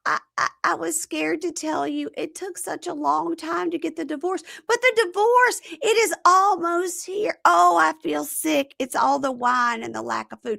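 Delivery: high, hammed-up wail of excuses; mock drunken whining. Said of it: "And then she goes".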